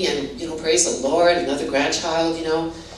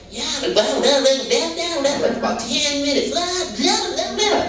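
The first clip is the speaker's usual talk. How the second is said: put-on high squeaky toddler babble, climbing higher; baby babble; 10 semitones higher than usual. Toddler granddaughter telling off her dad, high babbling. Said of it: "and she's like".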